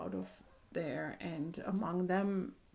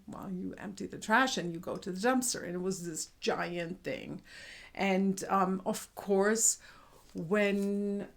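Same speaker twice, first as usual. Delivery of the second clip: usual speaking voice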